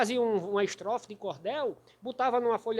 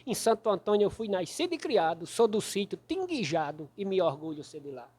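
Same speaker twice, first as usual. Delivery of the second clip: loud, rhythmic roll call of names; cordel verse recitation; 2 semitones lower, 6 dB louder than usual. Acted. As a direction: declaim the rhymes with a hammered beat. Recites rhymed verse about his hometown. (declamação de cordel, forte e ritmada)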